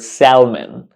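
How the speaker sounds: "salmon" with an audible L; wrong pronunciation. Shown as a demonstration. Teacher warning learners off a mistake, L voiced in "salmon". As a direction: sound the L in "salmon", SAL-mun. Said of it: "We don't pronounce this word".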